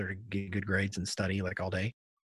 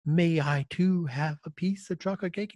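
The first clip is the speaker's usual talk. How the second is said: put-on higher, prim and formal child voice; overly polite kid; 6 semitones higher, 3 dB louder than usual. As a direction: ask like a posh little boy. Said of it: "he says".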